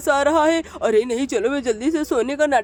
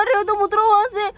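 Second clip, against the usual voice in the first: put-on loud, higher yelp at the kid; startled yell; 5 semitones higher, 3 dB louder, faster than usual. Father yelling at his son who jumped on him. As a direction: shout it as if the boy just jumped on you.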